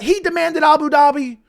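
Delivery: "demanded" and "Abu Dhabi" said with high, loud sarcastic scorn; mocking. Mocking what critics said.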